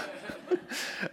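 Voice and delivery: a quick breathy chuckle; short laugh. Real, not acted. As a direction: laugh briefly at the joke. Laughs at his own remark. (kurzes Lachen)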